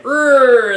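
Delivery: high straining growl; hammed-up drawn-out "rrr" of effort; mock slow-motion roar. Reviewer imitating Samson throwing men off, high mock roar. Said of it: "slow motion shot where he".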